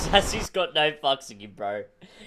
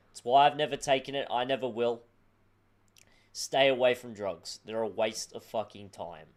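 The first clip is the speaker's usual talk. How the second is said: mock PSA; 4 semitones lower, 5 dB quieter than usual. Mock anti-drug PSA to kids.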